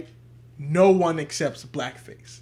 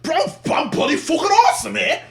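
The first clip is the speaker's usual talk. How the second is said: put-on British accent; 9 semitones higher, 5 dB louder than usual. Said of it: "it can be British".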